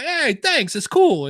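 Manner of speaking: very high, loud Jersey thanks; cheerful New York guy, cranked way up; made-up New York accent. Retold or imitated. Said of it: "horrible, weird accent I just made up".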